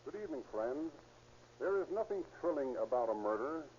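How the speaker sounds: usual speaking voice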